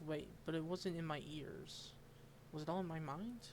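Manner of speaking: usual speaking voice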